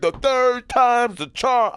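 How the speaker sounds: high, loud and slow, acting big; mock tough yell. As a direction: threaten her like a movie tough guy. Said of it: "I said".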